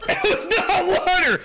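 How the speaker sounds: high, laughing protest; cracking up, high-pitched; laughing outburst. Real, not acted. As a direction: blurt it out through laughter. Reacting to fake water, laughing.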